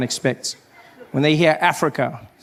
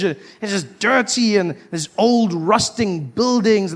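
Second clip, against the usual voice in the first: usual speaking voice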